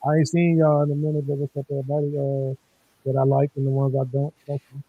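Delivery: muffled mic sound. Real, not acted. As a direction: voice as if through a wall. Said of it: "You sound muffled".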